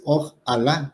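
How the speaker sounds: "ojalá" slowly split as "oj" and "Alá"; split into parts. Shown as a demonstration. Teacher showing the Arabic origin, "ojalá" divided as "oj, Alá". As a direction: break "ojalá" slowly before the stressed "Alá". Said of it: "no sé cómo se escribe en árabe".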